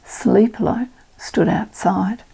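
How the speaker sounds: usual speaking voice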